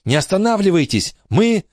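high, breathless cry cut off mid-word; urgent warning cry. Acted. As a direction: warn them in a panic, then choke off. Kate crashes into the others mid-run.

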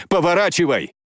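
high, sharp order; urgent command. Acted. Jane orders him back.

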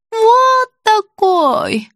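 high, very drawn-out cry; confused old woman. A narrator's reading. Patient showing the boy's height, confused old woman's voice. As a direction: stretch it out in a high childlike voice. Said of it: "повторила Евсюкова".

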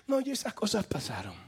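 normal talking voice

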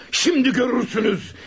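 angry shout; furious threat. Acted. Cornered robber threatens. (öfkeli bir tehdit bağırışı)

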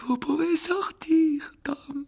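put-on high, slow, weary old wizard; disappointed old headmaster. A narrator's reading. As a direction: dismiss him with mild disappointment. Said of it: "l'air un peu déçu". (vieux directeur un peu déçu, voix aiguë)

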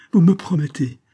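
high, loud, impatient challenge; impatient cry. A narrator's reading. Sick woman challenges Olivia's promise, impatient cry. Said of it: "s'écria Rachel Arden avec impatience".

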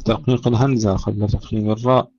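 ordinary talk

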